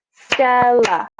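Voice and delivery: Stella stretched into two slow beats, Stel-la; syllable by syllable. Shown as a demonstration. Teacher counting beats in a name, Stella syllable by syllable. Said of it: "we clapped".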